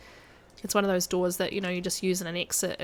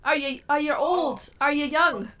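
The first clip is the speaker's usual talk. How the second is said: high, slow mock Scottish séance voice; ridiculous Scottish accent; 6 semitones higher, 4 dB louder, slower than usual. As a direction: ham up a Scottish accent calling the spirit. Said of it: "that ridiculous accent".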